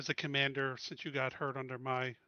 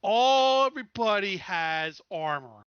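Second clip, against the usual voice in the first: raised, firm captain's order, slow at the end; commanding insistence; 5 semitones higher, 9 dB louder than usual. Acted. Captain tells the crew everyone gets armor, loud and firm. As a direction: lay down the rule for the crew.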